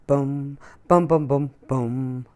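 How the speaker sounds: plain reading voice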